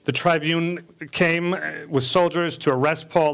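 ordinary delivery

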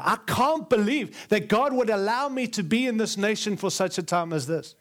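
ordinary talk, nothing marked

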